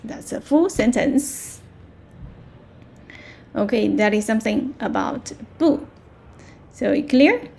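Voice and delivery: ordinary delivery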